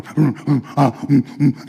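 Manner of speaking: low droning mm hums, imitating a circle of chanters; mock ritual humming; imitated chanting hums. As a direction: hum like a band in a strange ritual. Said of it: "they start going".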